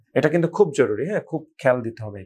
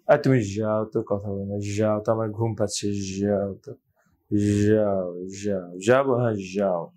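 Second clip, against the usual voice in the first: sleepy voice; 3 semitones lower than usual. Shown as a demonstration. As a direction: mumble it like someone half asleep. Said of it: "জটা হয়ে যাচ্ছে soft".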